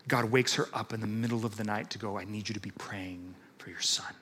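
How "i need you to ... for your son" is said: low, soft voice of God; lightly voiced God, low and soft. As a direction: speak it low and hushed, like a call in the night. God's words voiced in story.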